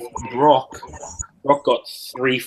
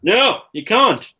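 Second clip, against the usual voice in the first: mock stern; 10 semitones higher, 4 dB louder, much slower than usual. Real, not acted. Mock-stern reply to chat.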